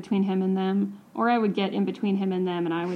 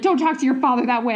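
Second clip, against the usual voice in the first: quick, louder mock reprimand; brisk, raised-voice retold scolding; scolding mom; 4 semitones higher, 5 dB louder, faster than usual. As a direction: re-enact a mother telling off her child.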